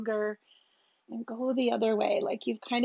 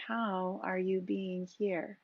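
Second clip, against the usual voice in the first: ordinary delivery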